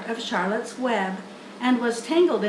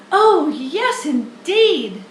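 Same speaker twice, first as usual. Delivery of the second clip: put-on high, loud eager young pig; young pig Wilbur; 5 semitones higher, 7 dB louder, slower than usual. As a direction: greet her like a thrilled piglet. Wilbur greets the spider.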